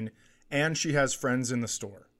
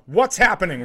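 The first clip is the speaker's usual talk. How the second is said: urgent, raised-voice question; loud retold demand; 5 semitones higher, 8 dB louder than usual. Mother running up to her daughter, loudly demanding. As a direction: call it out loud and higher. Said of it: "just as loud".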